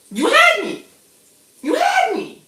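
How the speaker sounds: very high, falsetto swoon; swooning squeal. Real, not acted. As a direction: squeal it like a smitten fan. Swooning over the woman.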